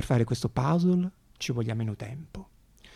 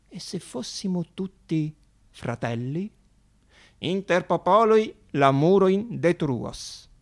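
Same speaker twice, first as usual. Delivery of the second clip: citazione solenne; 4 semitones higher, 4 dB louder, slower than usual. Acted. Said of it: "diceva così il dottore".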